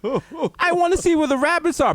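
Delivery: high-pitched, goofy mock Lennie. Mocking Mice and Men ending, a high, goofy voice. Voicing Lennie before the shot.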